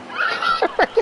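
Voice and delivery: high breathless wheeze of laughter; squealing laugh. Real, not acted. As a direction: laugh hard, high and airy. Laughing at own story.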